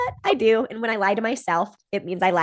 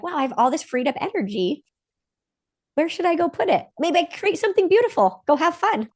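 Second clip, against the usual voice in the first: higher, bright, bubbling with new freedom; 1 excited freed self; 8 semitones higher than usual. Pretend. Freed inner self imagining fun, excited. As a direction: let the energy lift and run free.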